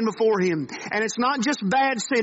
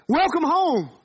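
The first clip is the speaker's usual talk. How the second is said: bright, raised proclamation of Welcome home; joyful welcome; 7 semitones higher, 3 dB louder, slower than usual. Acted. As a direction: Welcome home lifted high and louder, ringing out. Jesus welcoming the sinner.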